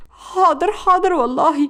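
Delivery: high-pitched frightened compliance. A narrator's reading. Beaten girl obeying the woman who threatened her, frightened. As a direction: plead obedience in fear. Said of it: "سمر بخوف ردت عليها".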